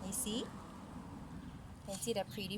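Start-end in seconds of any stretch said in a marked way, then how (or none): none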